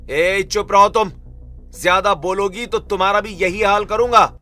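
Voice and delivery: loud, high angry warning; threatening. Acted. Husband silencing his protesting wife, loud threat. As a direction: shut someone down with a shouted threat. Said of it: "सूरज की इस धमकी के बाद".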